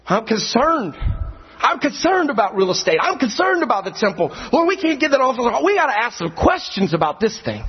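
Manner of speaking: whiny, worried mock disciples; mock their fretting about the temple. Preacher mimicking worried disciples, mock whine. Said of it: "have the audacity to sit down with Jesus Christ and say".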